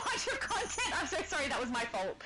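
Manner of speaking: normal talking voice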